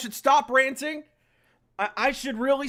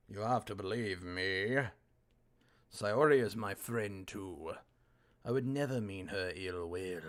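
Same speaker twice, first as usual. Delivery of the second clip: lower, softer earnest plea; low voiced pleading; 11 dB quieter than usual. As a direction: plead your innocence quietly. Voicing character pleading innocence.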